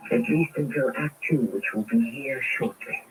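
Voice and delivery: measured announcer wrap-up; radio host narration. Acted. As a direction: tease the next act. Host closes act over music.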